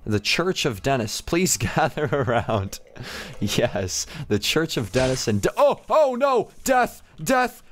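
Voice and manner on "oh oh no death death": alarmed cries. Real, not acted. Someone killed near him.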